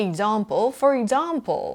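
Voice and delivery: 'Example' with a long 'ah' in the middle; British long A. Shown as a demonstration. Teacher repeating the British style, long 'ah' in 'example'. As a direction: say 'example' as 'ig-ZAHM-pul'. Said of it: "ถ้าเป็นแบบ British ก็จะเป็นตัว R ยาวๆ".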